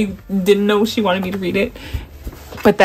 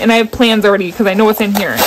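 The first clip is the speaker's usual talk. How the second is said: ordinary delivery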